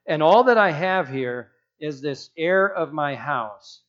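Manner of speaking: ordinary delivery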